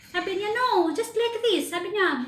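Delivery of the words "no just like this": imitating employer. Employer shows the way.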